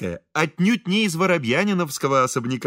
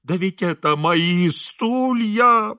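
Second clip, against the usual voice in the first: slow, high, drawn-out groan; moaning; 5 semitones higher, much slower than usual. A narrator's reading. Priest claiming the engineer's chairs, moaning. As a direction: moan it out, stretching the vowels. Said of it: "простонал отец Фёдор".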